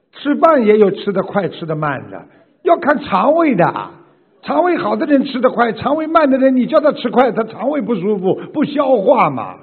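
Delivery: loud, high-pitched, forceful explaining. Real, not acted. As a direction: drive the point home at full volume. Teacher emphatically arguing by analogy.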